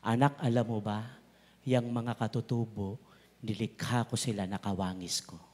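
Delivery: a hushed, tender divine voice; tender voice of God. Retold or imitated. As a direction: speak gently as God to His child. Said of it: "sabi sa akin ng Holy Spirit".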